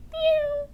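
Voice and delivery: high mewing meow; cat meow. Acted. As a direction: mew like a kitten. Cat sound imitated.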